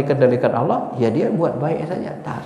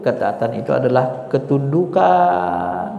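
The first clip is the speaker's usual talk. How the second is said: normal talking voice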